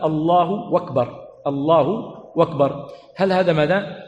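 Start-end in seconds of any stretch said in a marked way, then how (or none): 0.0-2.9 slow "الله أكبر" with "أكبر" said as "وَكبر"; drawn-out "Allahu wakbar"; waw replacing hamza. Shown as a demonstration. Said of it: "فإن الهمزة قد تحذف وتحل".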